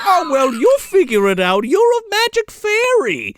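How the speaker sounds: high, breezy brush-off voice; mock Santa. Santa leaving it to the magic fairy, high mock dismissal. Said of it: "And he's like".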